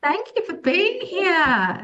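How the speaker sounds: high, slow, gushing welcome; warm welcome. Real, not acted. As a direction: welcome her warmly. Greeting a new guest.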